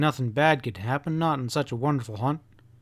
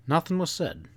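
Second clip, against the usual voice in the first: ordinary delivery, nothing marked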